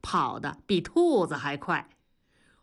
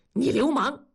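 high, loud mock-indignant outburst; a flustered, offended shot back at the tease; a hint of playful outrage; indignant retort; 7 semitones higher, 4 dB louder than usual. Comrade snaps at teasing friend.

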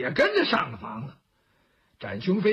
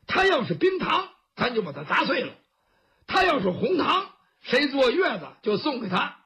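boisterous Zhao Hu; 2 semitones higher than usual. Acted. Zhao Hu scoffs at the Brocade Rat beside Master Zhan, boisterous bravado.

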